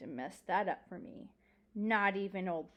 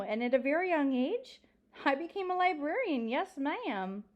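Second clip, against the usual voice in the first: put-on higher, louder, lively old librarian; old librarian storyteller; 6 semitones higher, 4 dB louder than usual. Old librarian telling a child about her library, proudly animated.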